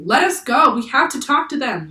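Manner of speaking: high, loud yell; yelling while struggling. A narrator's reading. Annie yells while kicking free of sailors, loud. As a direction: fight them off and yell. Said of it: "yelled Annie, kicking and flailing".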